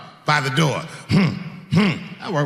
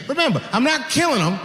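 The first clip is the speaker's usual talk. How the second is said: ordinary talk, nothing marked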